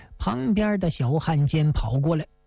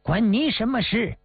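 mildly irritated, higher-pitched brush-off; touch of anger in a curt rebuff; angry retort; 5 semitones higher than usual. A narrator's reading. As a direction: snap back a little, pitched up. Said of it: "这小子气正不打一处来呢".

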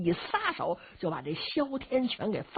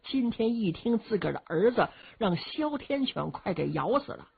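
ordinary delivery, nothing marked

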